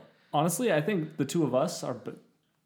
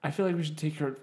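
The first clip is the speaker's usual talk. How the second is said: ordinary talk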